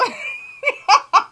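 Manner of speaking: loud high burst of laughter. Real, not acted. To her viewers, a loud high laugh. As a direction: crack up after calming.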